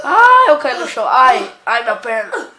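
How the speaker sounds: loud hammed-up cry of pain. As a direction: wail about the hurt leg. Fell and hurt leg. (choro de dor exagerado)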